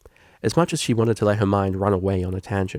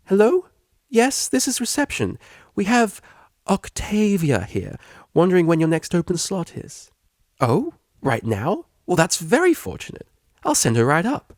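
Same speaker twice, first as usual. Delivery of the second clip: bright, high, eager helper voice; eager receptionist; 9 semitones higher, 4 dB louder than usual. Acted. Receptionist gushing to Octavia, high and eager. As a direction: gush like an overeager receptionist.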